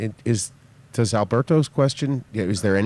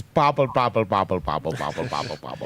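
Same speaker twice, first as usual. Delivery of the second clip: babbling mimicry that sinks lower and softer; imitating garbled talk; 6 semitones lower, 4 dB quieter than usual. Teasing a friend about his background talk, imitating garbled talk. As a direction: mimic background talk as meaningless burble, fading away. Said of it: "all I hear is".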